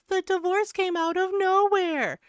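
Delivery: high, loud mock whine of a clueless husband. Streamer mocks a husband's shocked divorce whine. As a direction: play the baffled husband, hammed up. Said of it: "It's giving".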